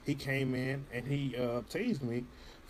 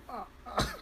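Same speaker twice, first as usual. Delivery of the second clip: imitated laugh; 12 semitones higher than usual. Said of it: "it's really cracking me up".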